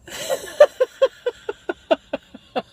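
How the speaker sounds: very high, loud laugh, trailing away slowly; hysterical laughter. Acted. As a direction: let crazed laughter peal and die away. Mad laughter closes soliloquy.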